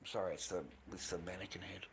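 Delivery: soft unvoiced whisper; whispered aside. Real, not acted. Whispering to chat that it's the mannequin head. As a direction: breathe it out as an aside.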